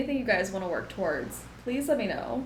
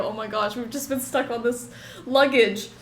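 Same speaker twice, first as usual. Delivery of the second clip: ordinary talk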